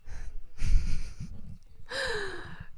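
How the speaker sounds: airy incredulous laugh; burst of laughter. Real, not acted. Stunned by a sloppy turnover.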